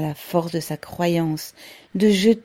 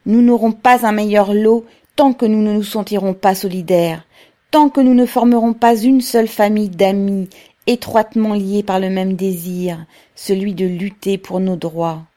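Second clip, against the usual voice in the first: ordinary delivery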